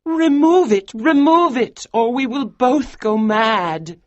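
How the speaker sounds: very high, loud begging; wife begging her husband to remove it; desperate plea. Acted. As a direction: beg him desperately. Georgiana begs.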